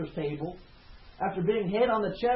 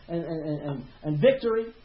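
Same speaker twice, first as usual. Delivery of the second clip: ordinary talk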